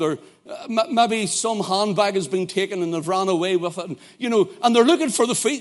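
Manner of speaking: normal talking voice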